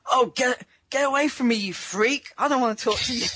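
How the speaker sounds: imagined yoga classmate brushing him off, mock disgusted; mock horrified woman. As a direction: play a woman recoiling in disgust, exaggerated.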